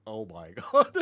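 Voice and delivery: imitating the kid, laughing through it; half-laughing, overwhelmed oh my God; a kid's startled laugh at a huge horse. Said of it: "like that".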